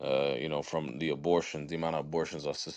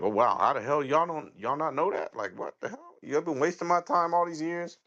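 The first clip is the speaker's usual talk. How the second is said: incredulous outburst; 9 semitones higher than usual. Retold or imitated. Someone else's shocked rant, retold.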